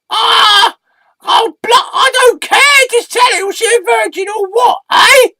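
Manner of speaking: very high, insistent shouting; impatient squeaky alter ego. Acted. Sidekick demands details from the storyteller, shrieky and impatient. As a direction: demand the gossip in a loud squeal.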